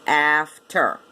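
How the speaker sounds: AFTER in two beats, AF-TER; by syllables. Shown as a demonstration. Teacher showing two syllables, AFTER said as AF-TER. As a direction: break AFTER cleanly into af and ter. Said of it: "Four sounds and two syllables".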